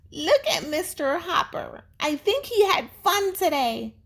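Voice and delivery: put-on very high child's voice; a hint of a happy young girl; little girl's voice. A narrator's reading. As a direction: sound like a delighted little girl.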